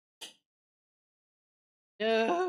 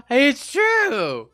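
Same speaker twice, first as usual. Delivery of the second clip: loud high laugh-sob; laughing-crying; 8 semitones higher, 5 dB louder than usual. Real, not acted. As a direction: laugh through tears.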